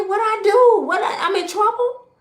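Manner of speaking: high, loud panicked squeal; shocked squeal. Retold or imitated. Mother hearing her son's news, panicked high squeal. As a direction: shriek it like you're in trouble. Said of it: "I said".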